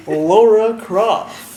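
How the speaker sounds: drawn-out announcement. Acted. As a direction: stretch the name out like a show host. Announcing the next contestant.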